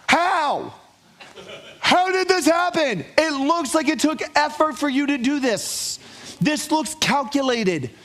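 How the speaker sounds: high, disbelieving questions about the wrecked car; incredulous wife. Retold or imitated. Wife confronting her husband over her wrecked car, incredulous. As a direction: play the stunned wife staring at the damage. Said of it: "she would ask".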